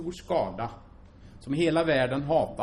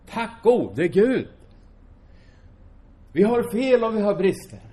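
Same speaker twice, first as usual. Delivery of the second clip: fervent praise; 7 semitones higher, 7 dB louder than usual. Acted. Preacher praising God before his church, loud and fervent. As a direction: cry out thanks to God.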